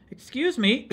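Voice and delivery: high little cricket voice; polite cricket. Acted. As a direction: pipe up to get his attention. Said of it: "coughed a cricket".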